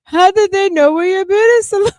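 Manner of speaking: high, loud, disbelieving question; mock incredulous caller. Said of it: "mocking the phone call".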